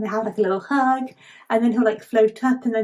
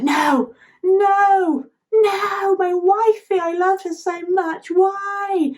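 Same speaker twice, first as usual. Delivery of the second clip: high, hammed-up royal wail; mock distress; 10 semitones higher, 4 dB louder, much slower than usual. King lamenting his lost wife, in mock distress. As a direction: cry out in exaggerated anguish, pitched way up. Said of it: "moment of complete distress from the king".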